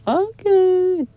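high, loud drawn-out okay; a hammed-up sing-song okay; mock okay. Accepting the challenge with a hammed-up high okay.

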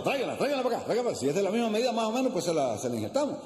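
high, urgent, quick-fire order; urgent surgeon. Acted. Surgeon urging in retold joke.

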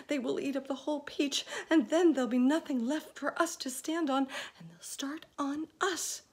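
high, fast and loud; panicked. A narrator's reading. Miss Spider panicking to the others. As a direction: rush it out in panic. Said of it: "wringing her feet".

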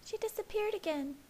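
Alice, shaken. A narrator's reading. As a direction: voice the sister, anxious. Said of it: "her voice shaking".

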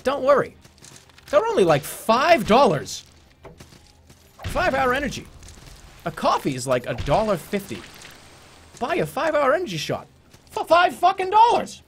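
loud, high-pitched hard sell; parody ad read. Pretend. Streamer pushing a cheap energy shot, hammed-up ad read. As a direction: shout the bargain like an over-eager pitchman.

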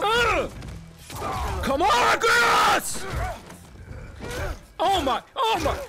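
usual speaking voice

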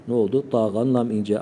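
ordinary delivery

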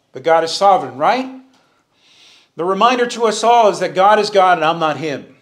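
ordinary talk

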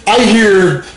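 normal talking voice

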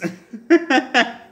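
shrill burst of laughter; high squealing laugh. Real, not acted. Laughing at her hiccup mess.

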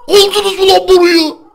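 high, loud victorious cheering; excited cheering. Real, not acted. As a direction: urge him on, triumphant.

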